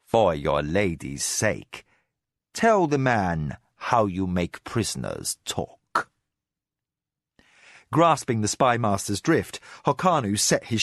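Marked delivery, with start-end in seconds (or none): 0.0-6.1 low, slow, icy instruction; mercilessly cold. Acted. Spy master prompting Hokanu for the prisoner's ears, cold. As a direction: coldly invite the torture talk. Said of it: "mercilessly cold".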